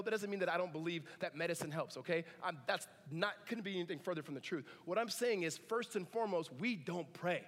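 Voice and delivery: normal talking voice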